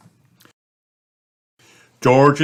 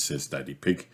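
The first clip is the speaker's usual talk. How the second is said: plain reading voice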